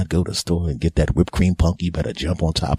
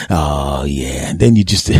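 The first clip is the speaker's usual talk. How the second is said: ordinary talk, nothing marked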